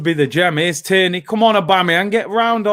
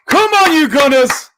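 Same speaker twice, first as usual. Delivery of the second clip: rallying cry; 8 semitones higher, 7 dB louder than usual. Real, not acted.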